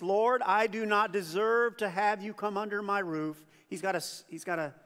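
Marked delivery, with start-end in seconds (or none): none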